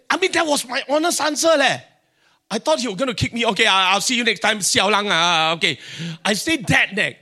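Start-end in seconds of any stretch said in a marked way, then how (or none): 3.5-5.8 loud, hurried brush-off; loud dismissal. Retold or imitated. Boss turning away a crazy job applicant, loud dismissal. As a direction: rattle it off loudly to get rid of someone.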